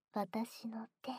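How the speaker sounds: slow ASMR whisper in the ear; soft whisper. Acted. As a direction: breathe it slowly, close to the ear.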